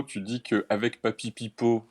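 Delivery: ordinary delivery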